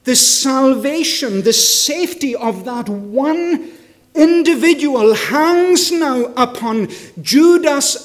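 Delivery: loud, ringing sermon delivery; full-force preaching. Acted. Preacher asks the congregation why Jesus came, preaching at full force. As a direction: preach it out to the whole hall.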